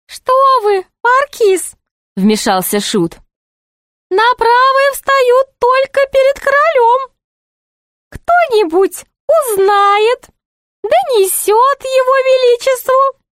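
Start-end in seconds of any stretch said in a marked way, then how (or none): 0.1-1.7 very high, sing-song jester voice; put-on high jester voice; high-voiced jester. 4.1-13.2 very high jester voice; high-pitched court jester tattling; meddling jester. Acted.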